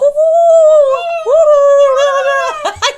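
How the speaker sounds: loud, high hooting creature call; long, high whoops like dying geese; dying-geese whistle call. Shown as a demonstration. Imitating the whistle call for co-host, loud honking hoots. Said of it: "You sound like dying geese".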